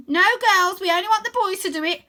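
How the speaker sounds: high, loud chief voice; bossy girl leader. A narrator's reading. Stella tells her girls only boys do it, bossy. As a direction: set the rules like a gang boss.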